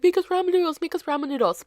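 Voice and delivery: put-on kids begging. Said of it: "they'd always be like".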